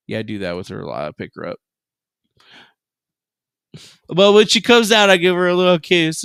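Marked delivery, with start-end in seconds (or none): 4.1-6.2 excited telling. Real, not acted. Excited retelling.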